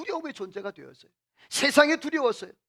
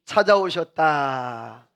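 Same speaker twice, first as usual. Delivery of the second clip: slow, lower call for the congregation to echo; slow call to repeat; 6 semitones lower, much slower than usual. Shown as a demonstration. Preacher leading the congregation, slow repeat-after-me call. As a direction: draw each word out so they can follow. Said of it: "따라서 합시다".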